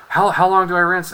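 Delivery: high, loud, rushed panic; suddenly desperate to get it right; panicked question. Retold or imitated. Student at the sink asking the teacher, panicked and loud.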